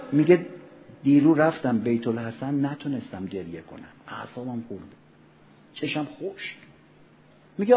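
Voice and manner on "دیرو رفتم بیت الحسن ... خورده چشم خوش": much softer and lower, grieving; sorrowful lament. Retold or imitated. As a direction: let the regret sink the voice. Believer upset he couldn't weep.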